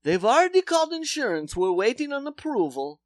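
brisk mock Polish; Polish accent. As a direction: dentist announcing insurance in accent. Dentist voiced.

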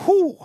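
high, loud relieved whew. Retold or imitated. After the racy book, a loud flustered whew.

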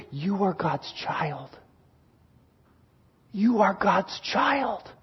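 slow, very soft, hushed assurance; hushed whispering. Acted. Spirit whispering to a struggling believer, hushed and slow. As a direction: breathe it out slowly and softly. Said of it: "and is whispering".

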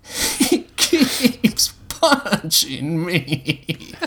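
very slow, high sobbing wail; drawn-out crybaby protest; tearful defensive yell. A narrator's reading. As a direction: whine it out through sobs. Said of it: "Josh yelled defensively".

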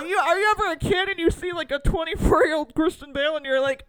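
loud high bro hype, laughing; hyped frat bro cheering. Acted. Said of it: "Sorry, that was so stupid".

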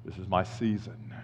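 a hushed, deep claim on Christmas; mock solemn vow. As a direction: murmur it like a vow. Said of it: "You're like".

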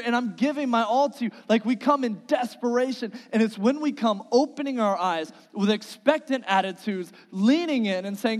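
loud, high, urgent preaching; full-force preaching. Acted. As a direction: pour it out to the congregation, pleading.

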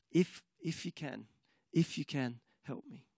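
soft, fading hesitant plea; father doubting, pleading with Jesus, despairing and soft. Retold or imitated. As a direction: trail off into defeated doubt.

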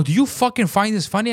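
high, indignant retold question; retold heated challenge; angry challenge. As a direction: confront them in outrage. Retold rant at the secretary.

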